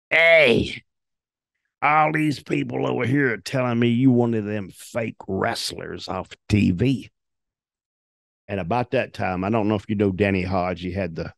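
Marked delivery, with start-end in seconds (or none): 0.1-7.1 big cowboy challenging. Retold or imitated. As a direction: bark it like a huge cowboy picking a fight. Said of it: "and he goes".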